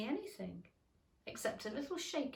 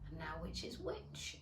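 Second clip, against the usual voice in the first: whispered, low and soft; to herself; 5 semitones lower, 9 dB quieter than usual. A narrator's reading. Alice puzzling over the mushroom, whispered. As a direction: mutter it under your breath. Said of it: "she said to herself".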